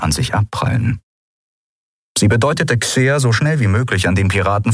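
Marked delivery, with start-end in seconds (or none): none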